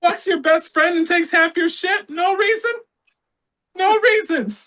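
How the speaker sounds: very high mock-indignant voice; comic falsetto caricature; comedy-bit impression. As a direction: squeak it in disbelief, hammed up.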